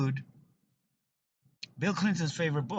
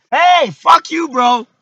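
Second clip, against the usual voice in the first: loud, higher shout of fuck you; angry shout; 20 dB louder, slower than usual. Real, not acted. Narrator yelling at another driver, loud and angry. Yells at someone outside.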